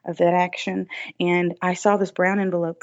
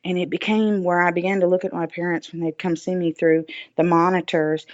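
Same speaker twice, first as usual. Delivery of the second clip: ordinary talk, nothing marked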